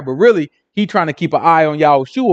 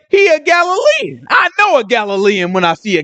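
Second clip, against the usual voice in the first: stretched, pitched-up finger-pointing; acted accusation; 7 semitones higher than usual. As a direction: play an accuser sure he has spotted him.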